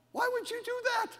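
very high, incredulous squeal; mock disbelief. Preacher to people who complain online, in high mock disbelief.

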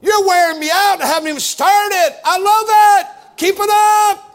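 laughing mock protest shout; loud preacher exclaim. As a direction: holler back at the happy crowd. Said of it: "I said".

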